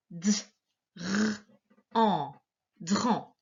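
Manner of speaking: DRAN split into D, R, AN, then blended; sounded out. Shown as a demonstration. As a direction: sound out D, R, AN with pauses, then join them as DRAN. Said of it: "tu vas écrire la syllabe dran".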